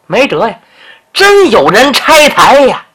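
usual speaking voice

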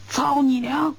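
high, loud retort; shouted curse back. A narrator's reading. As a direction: fire the insult right back. Guard curses back at general.

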